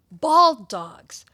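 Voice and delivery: usual speaking voice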